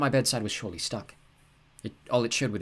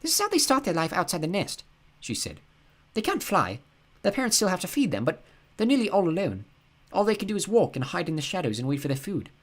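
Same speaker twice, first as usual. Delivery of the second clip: put-on higher young girl's voice, intent and a little louder; a girl sharing a vision, pitched up; Mina, earnest girl; 9 semitones higher, 3 dB louder than usual. A narrator's reading. Girl describing baby birds' dreams, in a higher voice.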